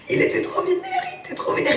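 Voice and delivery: words broken by laughter; laughing. Real, not acted. Telling friends about the angry officer, laughing. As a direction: laugh through it.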